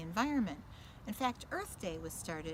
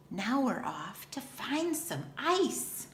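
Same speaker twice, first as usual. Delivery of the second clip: whisper; 5 semitones higher than usual. Acted. Setting off to icy place.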